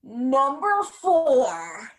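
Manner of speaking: drawn-out countdown call; slow dramatic announcer. Acted.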